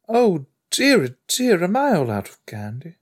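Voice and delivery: high, put-on fretful voice; high mock dismay; mimicked character. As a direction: play it high and dithering, oh dear. Mimicking a show character's line.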